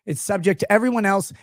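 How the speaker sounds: ordinary talk, nothing marked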